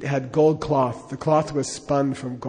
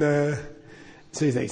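ordinary delivery

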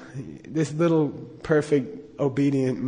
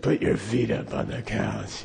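low, slow, softer damaged-voice lama; Darth Vader; 5 semitones lower, 5 dB quieter than usual. Retold or imitated. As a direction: imitate a teacher ordering through a crushed voice box, deep and slow. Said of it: "he talked like Darth Vader".

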